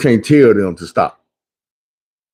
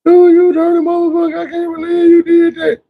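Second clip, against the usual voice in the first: very high, hammed-up wailing shout; mock crying shout. Retelling a betrayed woman on Steve Wilkos, hammed-up crying yell. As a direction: wail it like a betrayed wife on TV. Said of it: "she up here crying".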